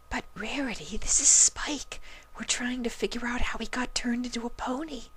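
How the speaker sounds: high, breathy whisper. A narrator's reading. Fluttershy telling Rarity the truth, whispered. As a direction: whisper it shyly. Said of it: "Fluttershy informed her".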